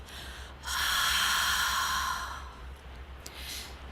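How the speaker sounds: long open-mouthed "haaa" breath without voice; breathy HA exhale. Shown as a demonstration. Teacher demonstrating Ujjayi breath, long audible HA sigh. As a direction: exhale slowly as if steaming up a mirror. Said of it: "making this H A sound".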